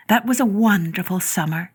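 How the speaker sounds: ordinary delivery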